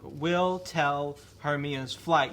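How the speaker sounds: slow, "will" and "tell" stressed in "will tell Hermia's flight"; operative words only. Shown as a demonstration. Host showing you need only the operative words, slow. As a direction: say only the key words slowly, hitting "will". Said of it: "it's interesting 'cause".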